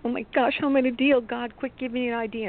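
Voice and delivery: fast, high, frantic plea to God; panicked thought. Retold or imitated. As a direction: rush it out like a panicked prayer. Panicked prayer over spilled paint.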